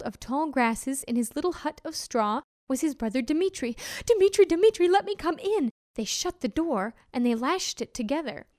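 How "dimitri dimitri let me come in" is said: high, loud frantic plea; panicked cry. Acted. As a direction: shout in a panic to be let in. Pig fleeing wolf calls out.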